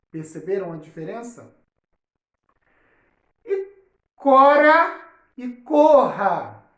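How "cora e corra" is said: "cora" with a tapped R, "corra" with a strong throaty R; slow, loud contrast: light R in "cora", aspirated R in "corra"; soft versus strong R. Shown as a demonstration. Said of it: "quando eu coloco os dois R's". ("cora" com R brando, "corra" com R forte, bem devagar)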